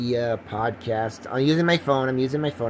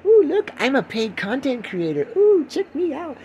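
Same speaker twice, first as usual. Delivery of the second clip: high-pitched, louder showing off; hammed-up boastful squeal; mock bragging; 13 semitones higher, 4 dB louder than usual. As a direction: crow with exaggerated self-importance. Mock bragging about tiny payment.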